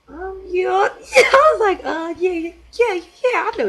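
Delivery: high, loud yeahs breaking into laughter; giddy bluffing. Retold or imitated. Answering the teacher about the company, giddy and overeager.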